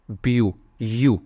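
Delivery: बीयू said slowly, then its vowel यू alone; syllable sounded out. Shown as a demonstration. Teacher showing learners the first syllable, बीयू, यू slowly isolated. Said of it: "जब आप इसको pronounce करोगे".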